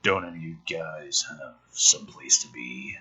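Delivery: low, deadpan script read; screenplay reading. Acted. Reading the script aloud for viewers, low and deadpan. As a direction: read the scene out dry and low.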